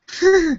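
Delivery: high, bright burst of laughter; sudden real laugh. Real, not acted. Laughs at own fun fact.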